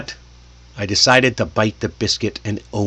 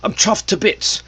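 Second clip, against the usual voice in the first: lightly put-on British accent, higher; 8 semitones higher than usual. Shown as a demonstration. Teacher introducing a British idiom, in a British accent. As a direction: try out a British accent, pitched up. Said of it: "the British accent".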